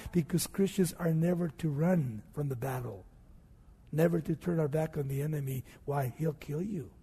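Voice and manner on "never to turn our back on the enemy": ordinary talk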